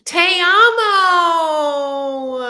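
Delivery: high, loud, drawn-out crying te amo; mock wailing te amo. Practicing te amo in a slow, high mock wail. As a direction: wail it slow like a weepy proposal. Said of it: "See, I can say it".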